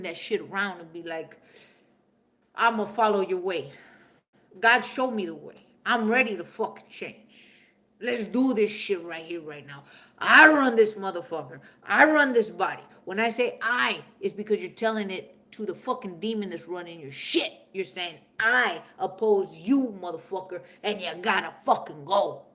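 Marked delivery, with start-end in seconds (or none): 2.5-9.8 louder and louder vow, all in; fervent declaration. Pretend. Speaking to God, turning your life around, a loud fervent vow. 10.2-12.8 loud, commanding yell of ownership; defiant shout. Pretend. Shouting down the demon inside, a loud defiant claim. Acted declaration against the demon. 18.4-22.5 defiant command. Pretend. Acted address to the demon.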